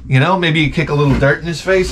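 usual speaking voice

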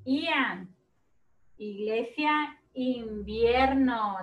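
slow, stretched words with spaced syllables; words for repeating. Shown as a demonstration. Second teacher continuing the list for children, slow and drawn-out. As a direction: draw each word out for pupils to echo. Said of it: "quiero que repitan las palabras".

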